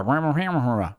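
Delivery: garbled voice over the phone; muffled phone voice. Acted. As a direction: talk like a muffled dispatcher on the line. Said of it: "and you hear".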